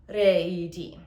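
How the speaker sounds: sharp gasp then re i di sung; quick breath then yodel. Shown as a demonstration. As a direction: sneak a breath, then sing re i di. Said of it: "I go".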